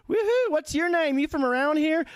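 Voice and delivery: put-on high-pitched whooping Southern accent; swampy Southern accent. Strange couple fixating on a comic, swampy Southern accent. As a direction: greet a stranger in an excitable Southern twang. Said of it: "they had, like, a swampy southern accent".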